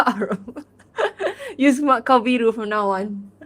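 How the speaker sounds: real laughter at a joke name; laughing. Real, not acted. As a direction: giggle it out.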